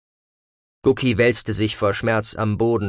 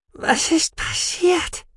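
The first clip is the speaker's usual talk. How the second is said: very high, slow squeaky voice; Gucki squeak; much slower than usual. Acted. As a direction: squeak it out weakly. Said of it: "piepste der Mausbiber".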